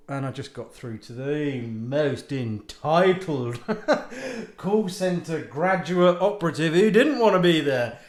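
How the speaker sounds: normal talking voice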